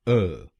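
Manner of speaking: अ said as a weak "uh"; a quick unstressed अ; syllable uh. Shown as a demonstration. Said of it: "हम बोलेंगे अ". (चौथा शब्दांश अ)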